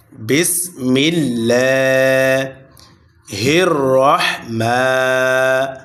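slow drawn-out chanted recitation; tajweed chant; Quran recitation. Shown as a demonstration. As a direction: recite it slowly with long stretched vowels. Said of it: "র মোটা হবে".

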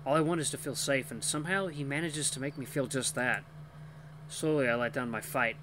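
usual speaking voice

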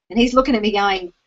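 usual speaking voice

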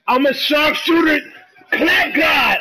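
high, hyped-up gamer shout; real hype. Real, not acted. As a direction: shout it out, pumped on your own shot. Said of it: "I got so hyped".

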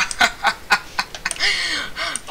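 real cackling fit, pitched high; high-pitched run of laughter; high helpless laugh. Real, not acted. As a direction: keep cracking up. Laughs at failed play.

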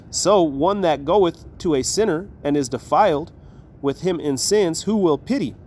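slow, solemn reading of the verse; measured King James recitation; scripture reading. Acted. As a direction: read it out like holy writ, slowly. Reads scripture aloud.

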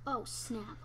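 ordinary delivery

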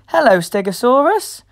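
plain reading voice

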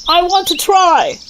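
a man wanting to try the sword; eager challenger. A narrator's reading. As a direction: step up boldly. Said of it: "says one of the men".

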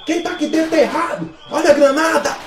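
grenade warning yell. Acted. Sergeants storm barracks at dawn.

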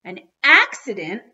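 loud, incredulous outburst; howl. A narrator's reading. Dad rejecting the accident excuse, loud incredulous howl. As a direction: throw the word back in outrage. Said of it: "howled my dad".

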